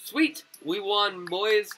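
high, loud victory shout; real joyful whoop; excited cheer. Real, not acted. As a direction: celebrate the win. Wins the game.

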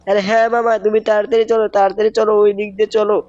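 put-on high, bright child voice begging uncle to hurry; high-pitched cartoon boy urging his uncle on; cartoon kid nephew. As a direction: play the eager little nephew.

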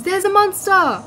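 imitating Italic. Teasing a friend who warns about the monster, mock alarm. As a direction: play the panicking friend shouting a warning. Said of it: "Italic just goes".

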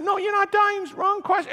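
high, urgent reassurance; panicked backtracking. Retold or imitated. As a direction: frantically take it back. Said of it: "she starts freaking out".

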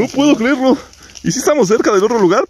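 can't believe the luck, voice up high; real excitement at finding the pair; excited disbelief. Real, not acted. Still thrilled at matching find.

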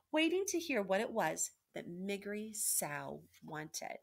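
ordinary delivery

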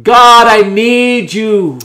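loud, slow, drawn-out plea; cried out in faith. Shown as a demonstration. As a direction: call it out to God with full conviction. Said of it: "Say it with faith".

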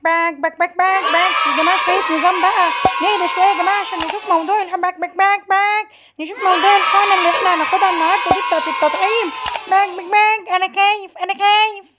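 high, loud quacking duck voice; panicky duck. Acted. Duck tells classmates about the injection, panicky. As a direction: squawk like a frightened duck.

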